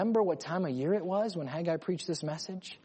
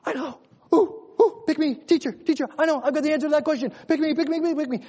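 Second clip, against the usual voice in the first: high, loud excited schoolkid begging to be picked; eager little kid; 10 semitones higher, 6 dB louder, faster than usual. Acted. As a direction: plead to be picked, high and urgent. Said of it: "you have this little kid".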